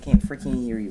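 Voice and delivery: loud teasing yell at the cat; mock shout. Real, not acted. Teasing the silent cat, loud mock shout. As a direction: playfully bellow that you can't hear.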